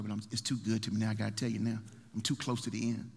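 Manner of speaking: usual speaking voice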